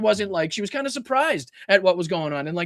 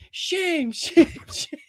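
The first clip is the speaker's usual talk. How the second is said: high, laughing mock chant of shame; mock rehearsing protester; 11 semitones higher, much slower than usual. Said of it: "the people that he thinks are in there going".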